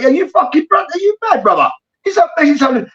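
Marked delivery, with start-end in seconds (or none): 0.0-1.7 high, teasing jab; mocking taunt. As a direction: needle him like a host mocking a guest.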